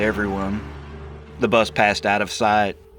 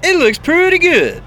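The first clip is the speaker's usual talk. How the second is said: much higher, loud bus driver calling down; Dwayne's voice; 10 dB louder than usual. Acted. Dwayne calls down from the bus, loud and high. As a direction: call it down the stairs, hooting.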